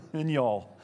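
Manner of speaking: a light Southern drawl on y'all, said with a laugh; Southern accent. Retold or imitated. Pastor jokes that Paul sounds Southern, light Southern accent. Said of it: "Kinda sounds like he's Southern".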